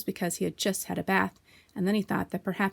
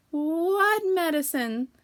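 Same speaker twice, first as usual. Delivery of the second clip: much higher, very slow wary squeak; Piglet asking; 9 semitones higher, 4 dB louder, much slower than usual. A narrator's reading. Piglet to Kanga about medicine, slow wary squeak. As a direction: ask in dread.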